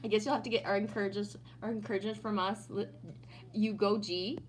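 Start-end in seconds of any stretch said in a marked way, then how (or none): none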